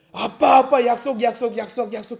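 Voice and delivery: put-on high, chanting kid's nagging; insistent child. Child nags dad about the promise, insistently. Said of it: "성령의 은혜를 받아서 방언이 터져요".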